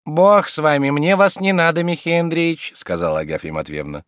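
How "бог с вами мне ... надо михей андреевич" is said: high, raised-voice woman refusing; landlady's rebuff. Acted. Agafya disowns the guest, indignant rebuff. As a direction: wave him off in a high voice.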